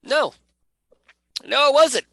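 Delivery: high, loud correction; emphatic no. Real, not acted. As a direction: shoot down the idea loudly. Loud denial of own guess.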